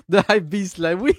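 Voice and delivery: excited cheer broken by laughs; gleeful laughing. Real, not acted. Viewer awaiting the outfit reveal, laughing with glee. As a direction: cheer between bursts of laughter.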